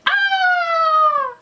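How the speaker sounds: very high, loud, held scream; shrieking lady. A narrator's reading. Lady sees Lance's painted face, high scream. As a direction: shriek on one long high note. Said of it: "And the lady went".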